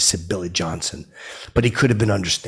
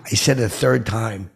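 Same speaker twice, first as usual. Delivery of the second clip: normal talking voice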